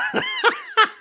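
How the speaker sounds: short shrieking laugh; high cackle. Real, not acted. Host laughing at her own joke, high cackle. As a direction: let out a quick high cackle.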